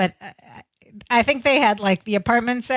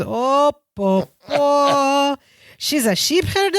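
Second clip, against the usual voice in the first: put-on sitcom voice, climbing high; Balky; 3 semitones higher, slower than usual. Balky misunderstanding the world's oldest profession, rising imitated voice. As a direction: sputter the syllables, then lift into a naive question. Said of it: "And Balky goes".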